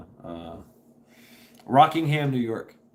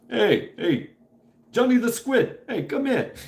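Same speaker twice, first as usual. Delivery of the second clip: high, loud mock New York mobster shout; hammed-up wiseguy hailing a buddy; cartoonish gangster yelling across the street; mobster calling out; 13 semitones higher, 5 dB louder than usual. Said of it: "the good fellas".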